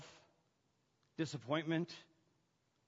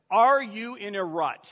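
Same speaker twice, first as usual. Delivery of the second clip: loud, raised preacher's challenge; forceful preaching; 6 semitones higher, 8 dB louder than usual. Real, not acted. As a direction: press the congregation with emphatic phrases.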